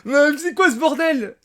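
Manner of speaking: high laugh into outraged question; real amused outrage; laughing disbelief. Real, not acted. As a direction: laugh then protest. He is killed unexpectedly.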